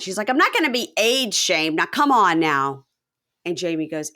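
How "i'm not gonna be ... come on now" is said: louder, higher indignant protest; mad. Retold or imitated. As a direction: refuse to be shamed, indignant. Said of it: "Jen gets all mad".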